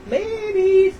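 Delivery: high, loud call to the babies; bright high call of babies. Real, not acted. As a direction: sing out to the little ones up high. Calling out to the hamster babies.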